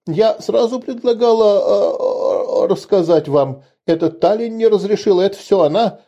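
put-on high-pitched frightened girl, stumbling over words; frantic pleading confession. A narrator's reading. Terrified girl confessing before punishment, choking out pleas in a high voice. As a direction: beg and blame someone else, voice pitched high. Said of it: "захлёбываясь словами, пыталась успеть сознаться".

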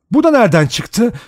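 high, loud demanding question; sharp suspicion. A narrator's reading. Vera questioning the brandy handed to her, sharp and high. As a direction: challenge them loudly.

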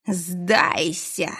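slow, eerie voice inside her head; a dark presence speaking in the mind; telepathic voice. Acted. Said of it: "Незнакомый голос звучал прямо в голове".

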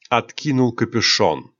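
ordinary delivery

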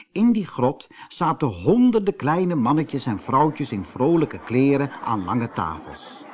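usual speaking voice

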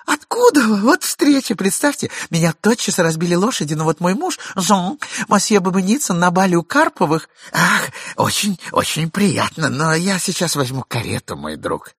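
high, bright flustered prattle; chirping chatter. Acted. Wife chatters to Babynitsyn, flustered. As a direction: chatter on to cover up. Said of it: "защепетала дама".

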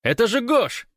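very high, loud yell; excited shout. A narrator's reading. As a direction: yell the name up to comrades. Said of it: "крикнул он наверх".

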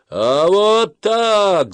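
long-drawn, high, loud yell. A narrator's reading. Boy shows friends how to scare everyone, yelling. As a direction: holler it slowly into an echoing archway. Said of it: "заорал Колупаев".

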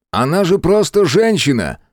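loud, high, steady voice; loud and calm. A narrator's reading. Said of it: "громко и спокойно сказал Мэлори".

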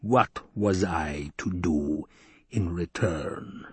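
slow, low spat words. Acted. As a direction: spit each word out slowly. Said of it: "he spat the words out".

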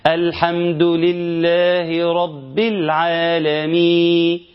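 slow chant ending العالمين without the ن; slowly chanted verse with the last letter swallowed; dropped final nun. Shown as a demonstration. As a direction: let العالمين fade out before its final ن. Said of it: "أنا ما نطقتش الحرف الأخير".

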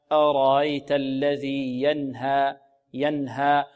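slow recited أرأيت الذي ينهى, clear short nun; غنة ناقصة. Shown as a demonstration. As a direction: sound the nun of ينهى cleanly without lingering nasality. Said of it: "فتكون الغنة ناقصة. هكذا". (ينهى بإظهار النون دون زيادة غنة)

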